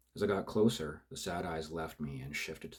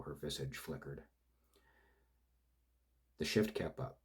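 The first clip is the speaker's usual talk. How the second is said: usual speaking voice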